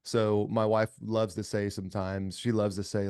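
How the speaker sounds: ordinary talk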